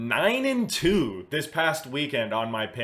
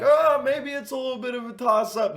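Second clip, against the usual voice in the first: much higher, a little louder, mock unsure voice; mock his own earlier waffling, pitched way up; mock doubtful self; 13 semitones higher, 5 dB louder than usual. Host mocking his own doubt about the fight, high mock voice. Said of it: "I was kinda like".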